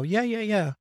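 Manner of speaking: high, quick dismissive yeah yeahs; dismissive brush-off. Retold or imitated. Someone half-listening waves off an apology, dismissive. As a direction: wave off the apology with a careless yeah.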